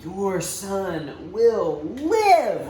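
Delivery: very slow, savoured promise; trusting inner voice. Acted. Father on the road repeating Jesus' promise, slow and trusting. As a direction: hold onto every word like hope.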